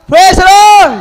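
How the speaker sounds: loud, high joyful shout; shouted praise. Real, not acted. Preacher shouts for a miracle, loud high cry. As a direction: shout it out to the whole church. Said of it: "hindi ka ba sisigaw".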